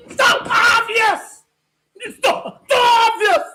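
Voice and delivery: loud, high, drawn-out cries of wow; overexcited hollering, near tears; excited yelling. Real, not acted. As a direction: shout in stunned delight. Reacting wildly to friend's take.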